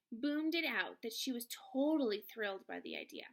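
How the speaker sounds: plain reading voice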